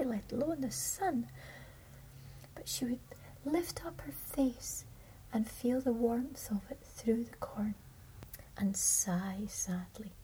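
ordinary delivery, nothing marked

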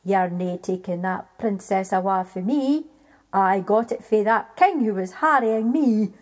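loud, gruff giant refusal; the giant digging in his heels; giant's defiance. A narrator's reading. Giant refusing to hand over the princess, loud and defiant.

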